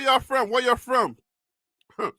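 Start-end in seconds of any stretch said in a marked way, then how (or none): none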